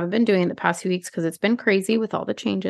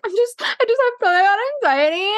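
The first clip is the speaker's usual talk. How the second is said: very high, squeaky mock whine; shrill hammed-up victim voice; mock whiny girl; 14 semitones higher than usual. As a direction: mimic the girl pleading anxiety.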